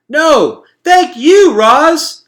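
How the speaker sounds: high, loud shout from the crowd. A narrator's reading. An animal in the crowd thanks Roz, shouting. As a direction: call out across the meadow. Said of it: "shouted a voice".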